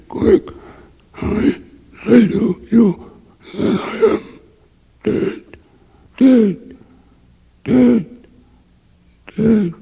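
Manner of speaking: breathy then high, cracked, desperate pleading from beyond death; a dead man begging to be released; hideous dead voice. Acted. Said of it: "the same hideous voice which I've already described broke forth".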